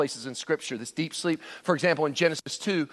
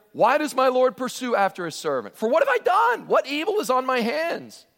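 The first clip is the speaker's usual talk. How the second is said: anguished plea; 9 semitones higher, 3 dB louder than usual. Acted. David pleading with Saul.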